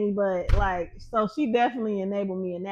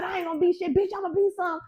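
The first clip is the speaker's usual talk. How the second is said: high, indignant comeback; reenacted heated retort; changed voice, retold; 8 semitones higher, 3 dB louder than usual. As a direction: throw it back defiantly, pitched up. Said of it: "My whole voice was different".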